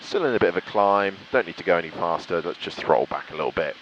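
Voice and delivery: ordinary talk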